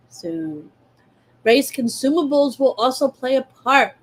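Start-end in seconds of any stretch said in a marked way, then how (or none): 0.1-0.7 whispered teasing soon. Shown as a demonstration. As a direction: breathe the word soon. Teasing future feature.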